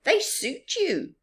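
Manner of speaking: high, loud and bright-hearted; cheerful Baboon. Acted. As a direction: reassure your friend. Baboon voiced in story.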